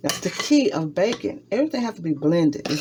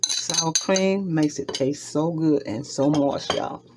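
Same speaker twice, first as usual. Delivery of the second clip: usual speaking voice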